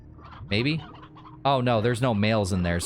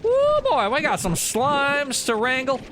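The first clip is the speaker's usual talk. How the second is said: high-pitched, hammed-up ranch hand; put-on rancher; 11 semitones higher than usual. Rancher sizing up the slimes to wrangle, hammed-up high voice. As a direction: play a rancher up in a high register. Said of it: "I don't know why I'm talking like this".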